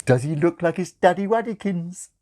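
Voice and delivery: put-on high, cooing baby talk. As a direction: coo at the baby.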